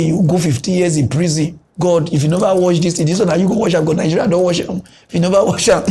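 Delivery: ordinary talk, nothing marked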